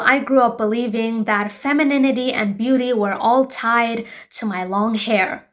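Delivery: usual speaking voice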